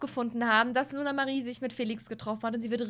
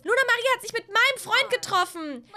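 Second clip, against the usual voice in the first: high, loud accusing; angry tattling; 10 semitones higher, 5 dB louder than usual. Acted. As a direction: tell on her, furious. Telling mom on sister.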